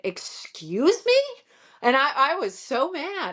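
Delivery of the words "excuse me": slow, higher, offended excuse me; indignant excuse me. Retold or imitated. To a stranger who bumped her, slow indignant excuse me. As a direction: draw it out, affronted. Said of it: "And I said".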